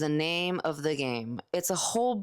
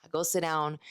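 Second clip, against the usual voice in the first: usual speaking voice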